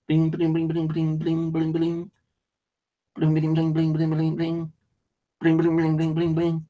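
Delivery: high repeated bling, bling imitation; phone blinging. Woken by phone, imitating its nonstop chime high. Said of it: "Bleat for about five minutes".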